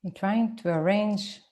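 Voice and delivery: ordinary delivery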